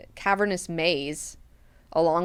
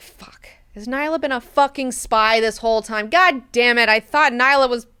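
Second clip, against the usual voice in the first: loud, higher, cursing in outrage; furious realization; 6 semitones higher, 7 dB louder than usual. Retold or imitated. Siona realizes her guard was a spy, loud angry cursing. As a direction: blow up at the betrayal.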